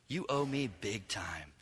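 low, hushed, slow warning; hushed threat. Pretend. Father warning his son, in a hushed mock threat. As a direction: lean in and say it low and menacing. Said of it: "some lecture from the Father".

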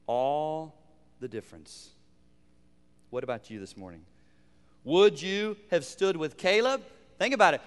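ordinary delivery

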